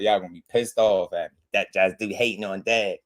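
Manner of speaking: usual speaking voice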